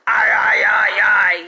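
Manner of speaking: loud pirate yell, aye aye. Acted. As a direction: shout it out as the pirate captain. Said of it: "yells Hook".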